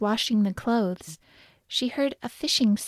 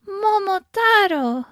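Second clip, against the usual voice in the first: put-on high, drawn-out call as the old woman; slow, high-pitched storybook exclamation; old woman's cry; 10 semitones higher, 4 dB louder, slower than usual. A narrator's reading. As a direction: cry the name out slowly, pitched way up. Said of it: "cried the old woman".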